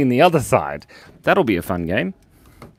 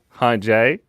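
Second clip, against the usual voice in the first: usual speaking voice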